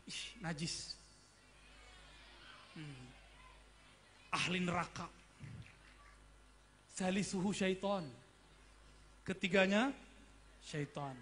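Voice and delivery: a mild mock disgust, scornful; judgmental sneer. Preacher mimics a self-righteous student judging a couple, mild scorn.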